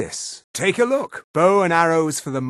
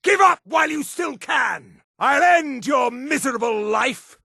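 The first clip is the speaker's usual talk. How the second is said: raised, forceful battle threat; threatening shout; 4 semitones higher than usual. Acted. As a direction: order the enemy to surrender or die. Threatening an enemy in combat.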